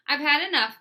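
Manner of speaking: high, loud flustered outburst; a teacher at the end of her rope; ruffled teacher. A narrator's reading.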